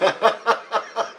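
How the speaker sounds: high burst of real laughter; scornful laugh. Real, not acted. Patient mocks what hospital would say, scornful laugh. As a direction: crack up at the absurdity. Laughs at spiritual implants joke.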